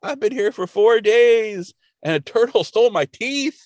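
put-on high, drawn-out old man plea; begging. Grandpa Simpson begging for help in the woods, high pleading. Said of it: "he's begging for help".

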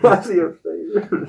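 mock puzzled kid. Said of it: "mocking the kids' question".

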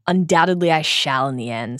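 normal talking voice

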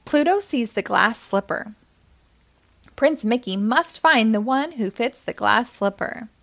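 usual speaking voice

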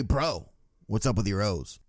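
lightly voiced bro, low and soft; mock casual bro. Acquaintance needling an Orioles fan, mock casual bro. Imitates person bumping into him.

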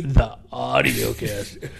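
loud, slow announcer call. Acted. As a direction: boom it like a show intro. Podcast sign-off correction bit.

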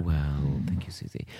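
soft unvoiced whisper; whispered thanks. Real, not acted. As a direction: breathe it out, bashful. Mock modest reply.

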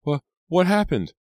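very high, loud stammered question; acted horrified squeal. A narrator's reading. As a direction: gasp the question in shock.

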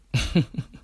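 short self-amused laugh; amused chuckle. Real, not acted. Host recalling a three-year relationship, amused chuckle. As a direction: chuckle at your own story. Amused by his own story.

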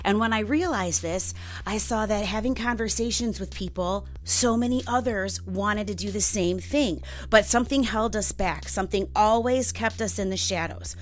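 upbeat host intro over music; bright, punchy show-opener read; podcast intro read. Acted. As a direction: sell the show to new listeners.

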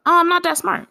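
bright high mock-modest protest. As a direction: brush off the compliment, pitched high and light. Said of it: "I looked at him and I said".

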